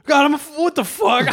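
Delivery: loud, high yelp of shock; retold outburst. Comic retelling his own drugged outburst, loud high yelp.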